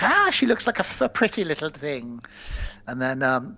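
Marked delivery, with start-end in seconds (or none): 0.0-2.2 leprechaun. Acted. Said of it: "the leprechaun's like".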